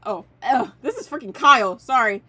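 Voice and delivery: loud, startled outburst; loud surprise. Real, not acted. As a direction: burst out in surprise. Surprised to see a friend.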